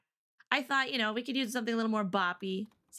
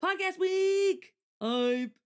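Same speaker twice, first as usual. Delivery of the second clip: high, drawn-out, louder announcement; hype cheer; 9 semitones higher, 4 dB louder, much slower than usual. Real, not acted. Streamer celebrating a week of podcasts, hype cheer.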